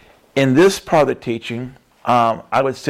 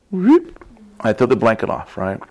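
ordinary delivery

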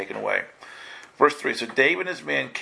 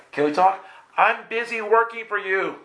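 higher, pleading; pleading God; 8 semitones higher than usual. Retold or imitated. Preacher voices God asking churchgoers to talk, higher pleading. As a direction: ask to talk, then brush off.

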